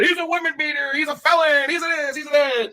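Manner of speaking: high, sneering parody of the accusers; mocking accusers. Host parodies the people spreading rumours, high mocking voice. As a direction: whine it like the haters spreading rumours. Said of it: "all these narratives and stories".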